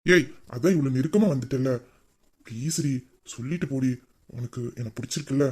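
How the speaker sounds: hushed loving plea to his wife; soft coaxing plea. Acted. Husband softly coaxing a confession.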